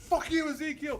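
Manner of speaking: high-pitched put-on voice snapping the insult; mock shouted insult. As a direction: spit it out like the guy in the video. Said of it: "He goes".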